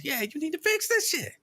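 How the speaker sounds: high-pitched mock exasperation; retold exasperated agreement. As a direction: agree pointedly, voice shooting up high. Retold exasperated reply to wife.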